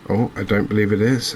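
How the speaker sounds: normal talking voice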